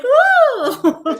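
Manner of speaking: high laughing whoop; excited woo. Retold or imitated. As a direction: let out a giddy, laughing woo. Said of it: "It's like".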